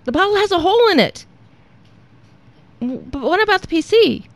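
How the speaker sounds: high-pitched, bewildered voice; Lucifer. Acted. Demon puzzled by Satan's choice, protesting.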